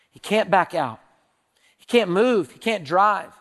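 ordinary talk

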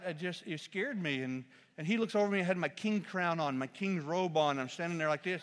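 normal talking voice

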